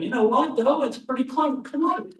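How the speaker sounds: high, tickled farmer voice won over by a rhyme; amused old farmer. Acted. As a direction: play the farmer warming to the boy's cleverness. Farmer voiced in told joke.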